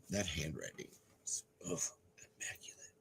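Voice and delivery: breathless whispered praise; hushed wonder; awed whisper. Real, not acted. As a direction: marvel at it under your breath. Awed by handwriting.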